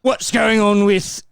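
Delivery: slow, drawn-out mock Cockney; playful fake Cockney, slowed down; Cockney accent. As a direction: ham up a London geezer, slowly. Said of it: "it becomes Cockney".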